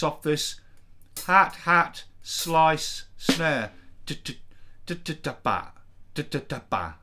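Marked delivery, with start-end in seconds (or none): 4.0-6.9 vocal drum beat, syllables spat out; beatboxed drum pattern. Shown as a demonstration. Producer beatboxing a reggae drum pattern. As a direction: mouth the snare rhythm like a drum machine. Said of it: "like that".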